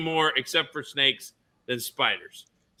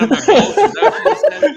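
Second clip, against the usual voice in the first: laughing hard, voice jumping up; 8 dB louder than usual. Real, not acted. Cracking up at his friend's misheard joke, laughing. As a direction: crack up while repeating the line.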